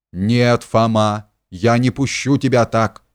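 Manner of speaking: ordinary delivery, nothing marked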